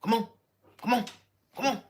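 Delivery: annoying gym buddy. Retold or imitated. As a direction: nag someone through a bench press. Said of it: "he's standing over me".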